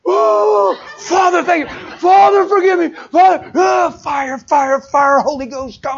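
loud, high tearful shouted prayer; loud, sobbing revival prayer; crying out in prayer. Retold or imitated. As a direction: cry out to God at full volume.

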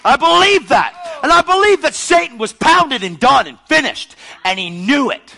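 high, loud pulpit declaration; fervent, shouted sermon; full-force preaching. Real, not acted. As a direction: preach it at the top of your voice.

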